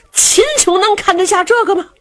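plain reading voice

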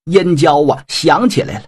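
usual speaking voice

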